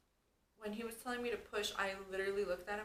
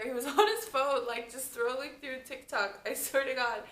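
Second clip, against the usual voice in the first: laughing; 4 semitones higher, 8 dB louder than usual. Real, not acted. Laughing at husband on phone.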